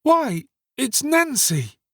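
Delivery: put-on high, drawn-out boyish surprise; astonished exclamation. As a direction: exclaim as you suddenly recognize her. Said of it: "exclaimed Oliver".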